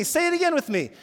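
loud, high urging; impassioned call over the room; preacher rallying congregation. Real, not acted.

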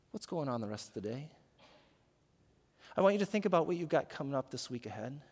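ordinary talk

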